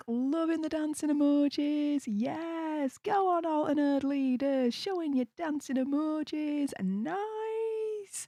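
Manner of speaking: high, lively hype for the crowd; excited cheering. Real, not acted. Streamer cheers on chat's dancing emojis, excited.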